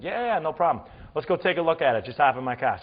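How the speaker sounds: breezy New England local taking charge; New England accent. Retold or imitated. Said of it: "had the best accent ever".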